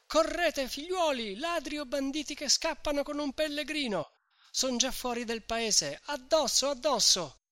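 high, urgent call to chase; rally the villagers after the bandits; urgent shout. A narrator's reading. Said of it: "e grida".

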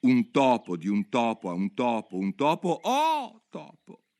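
"un topo, oh topo" recited like a Latin lesson, rising to a slow high "oh topo"; reciting declension. Acted. Alice recalls her brother's Latin grammar, schoolroom recitation. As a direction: chant it like grammar drill.

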